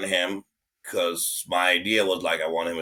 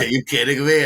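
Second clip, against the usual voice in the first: higher, louder mock disbelief; incredulous; 7 semitones higher, 6 dB louder than usual. Friend quoted reacting to the guest, higher, louder disbelief. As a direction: throw it out in disbelief. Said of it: "She's like".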